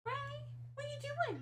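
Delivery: very high, cooing question; teasing her cat in a high pet voice; pet talk. Real, not acted. Talking to the cat.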